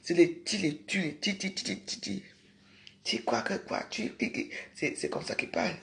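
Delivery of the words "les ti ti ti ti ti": ordinary talk, nothing marked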